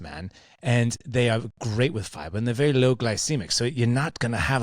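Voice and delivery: a mildly put-on Kiwi accent, slowed on the berries; lightly faked New Zealand accent; bad New Zealand accent. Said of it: "I'm gonna butcher his accent".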